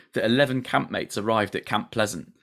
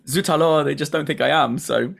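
light put-on French accent, a bit higher and louder; mock French accent; 5 semitones higher, 4 dB louder than usual. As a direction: ham up a French accent while laughing. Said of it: "he's changed his accent for the show".

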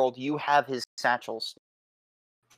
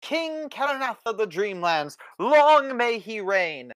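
pompous fussy courtier; 7 semitones higher than usual. Acted. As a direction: announce it grandly like a royal herald. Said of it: "Henry says".

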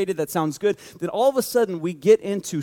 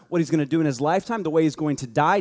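ordinary talk, nothing marked

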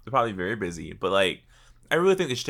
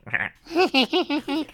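much higher, slow squeal of the word; mocking squeaky high repeat; slower than usual. Shown as a demonstration. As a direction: parrot it back in a shrill mocking pitch. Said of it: "That's out of pocket".

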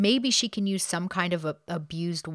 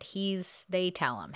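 ordinary talk, nothing marked

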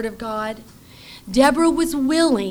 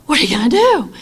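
mild fretting; 3 semitones higher, 6 dB louder, faster than usual. Retold or imitated. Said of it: "that is my nature".

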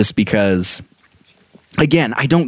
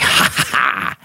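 breathy unvoiced ha ha ha; smug fake triumph laugh; mock gloating laugh; slower than usual. After smushing gummy bear back, breathy gloating laugh.

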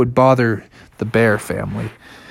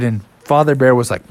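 plain reading voice

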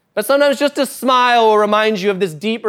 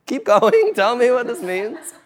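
put-on higher, laughing voice of an old monk; playful Dalai Lama; 5 semitones higher, slower than usual. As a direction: play the amused monk lightly.

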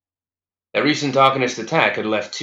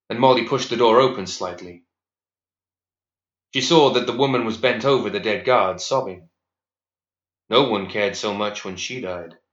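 plain reading voice

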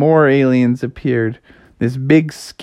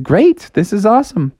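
higher, upbeat exclamation; pleased, raised-pitch excitement; delighted; 8 semitones higher than usual. Acted. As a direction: cheer at the lucky find. Frog happy to find turkeys.

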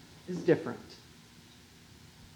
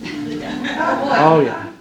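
laughing; 3 semitones lower, 6 dB louder than usual. Real, not acted. Laughs after saying Satan.

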